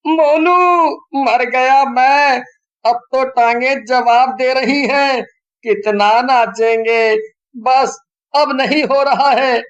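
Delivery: high, loud worn-out whine; exhausted boy. A narrator's reading. Sonu complaining to Monu, worn out from dancing. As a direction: groan it like your legs are giving out. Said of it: "Sonu Monu से कहता है".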